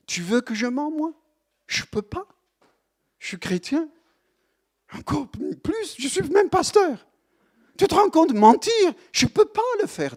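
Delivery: high, rising outraged protest; imitating himself indignant. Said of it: "Tu es venu me dire".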